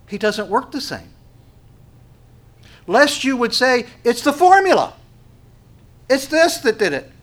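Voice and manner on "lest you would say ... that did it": high, loud, forceful outburst; raised-voice warning to the congregation; impassioned preaching. Real, not acted. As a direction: preach it at full force, pitched way up. Preacher exclaims against formulas.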